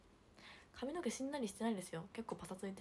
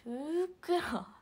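drawn out; 7 semitones higher, 9 dB louder, much slower than usual. Real, not acted. Streamer drags out the chat word ふっくら slowly and loudly.

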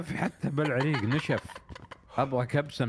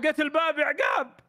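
shouting the news with joy; excited shout; 13 semitones higher than usual. Real, not acted. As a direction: yell it out, thrilled. Excited shout finding the door.

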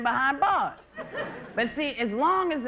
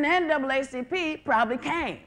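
ordinary delivery

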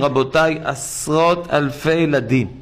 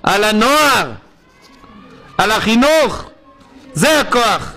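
tearful plea; 5 semitones higher than usual. Real, not acted. Preacher urges weeping for children.

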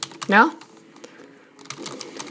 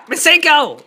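attack call; 6 semitones higher than usual. Pretend. Calling out a game attack.

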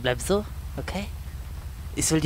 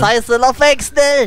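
high, loud alarm call; urgent shout; 8 semitones higher, 6 dB louder, slower than usual. Pretend. Player telling friends to flee, urgent shout. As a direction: shout at them to get away fast. Urges friends to run away.

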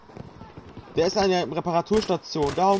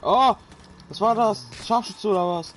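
startled outburst; 6 semitones higher, 4 dB louder than usual. Real, not acted. Sudden hit in game.

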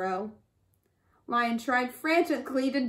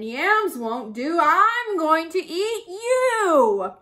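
menacing lion; 4 semitones higher, 5 dB louder, slower than usual. A narrator's reading. Lion threatens bush rat.

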